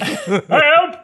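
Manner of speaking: high, loud comic yelp; mock cry for help. Joking about the lion's den, mock cry for help. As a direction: yell it in pretend peril.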